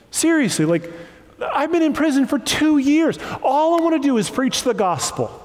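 high, whining self-pity; whiny complaining. Pretend. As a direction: whine like a sulking victim. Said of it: "Paul would just be sitting on the boat in a corner being like".

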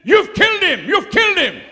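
high, loud panicked shout; doctor screaming accusations at the preacher. Retold or imitated. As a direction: yell it as an outraged doctor. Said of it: "the doctor jumped up and said".

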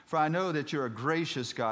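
high, loud Southern preacher reading scripture; Southern-accented pulpit plea. Acted. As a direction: proclaim the prayer with a Southern lilt. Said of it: "I don't know why I'm speaking Southern".

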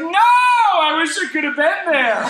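loud, high, slow and laughing; hooting laughter; laughing delight. Retold or imitated. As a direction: wish you were there, cracking up. Friend laughing on phone.

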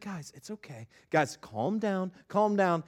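very soft, soothing hush; imitated gentle mom; gentle wife. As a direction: quietly settle squabbling kids. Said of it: "my wife is a very gentle soul".

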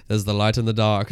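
low-pitched mentor voice; a hint of a solemn sage; Obi-Wan Kenobi. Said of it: "his little Obi-Wan voice".

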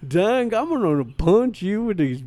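hillbilly voice. Acted. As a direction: play a dumb country Superman. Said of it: "Superman should be a stupid hillbilly redneck".

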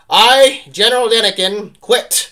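high, loud, hammed-up proclamation; comically grand shouted sign-off; mock resignation announcement. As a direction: call it out like a farcical farewell to the troops. Mocks general's resignation announcement.

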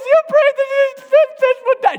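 very high, loud crying accusation; hammed-up little sister wailing at her dad; imitated bawling. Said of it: "She's bawling, bawling".

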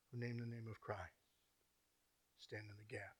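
very soft, low, intimate plea; hushed prayer. Acted. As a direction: pray it barely above a whisper. Whispered intercessory prayer.

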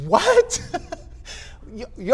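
very high, laughing yelp of disbelief; incredulous shock. Acted. Followers hearing they must drink blood, incredulous shock. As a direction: squawk it in comic astonishment.

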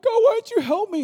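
high, loud wail of complaint to God; hammed-up tearful whine; mock crying. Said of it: "you look at him and crying".

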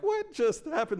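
high, stunned question; shocked disbelief. Retold or imitated. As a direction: ask it in sheer disbelief. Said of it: "I'm like".

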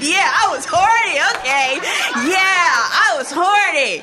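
loud, high, drawn-out gleeful admission; emphatic confession. Acted. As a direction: belt it out like a shameless confession.

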